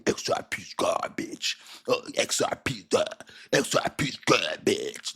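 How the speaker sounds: mock whiny hater voice, slow; lightly voiced naysayer. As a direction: sneer like a clueless critic. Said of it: "Like that".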